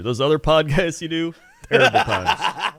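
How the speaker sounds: a playful, laughing dig at his other shows; laughing tease. Real, not acted. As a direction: rib him with a laugh. Teasing about other podcasts.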